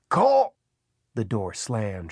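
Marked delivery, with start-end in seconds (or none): 0.1-0.5 loud, very high one-word yell; Pelham shouting. A narrator's reading. Man repeating order to frightened boy, shouting. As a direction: yell the order with full urgency. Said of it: "Pelham shouted".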